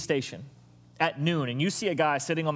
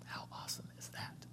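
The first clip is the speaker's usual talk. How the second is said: whisper; 21 dB quieter than usual. Real, not acted.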